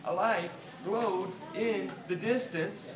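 ordinary delivery

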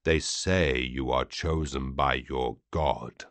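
mock the prisoner's faith quietly; alien lord's voice. Vati mocking the captive's god, low and cold.

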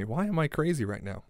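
normal talking voice

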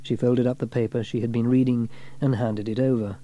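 ordinary delivery

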